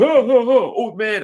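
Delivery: high, loud mocking thug voice. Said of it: "some people are picking".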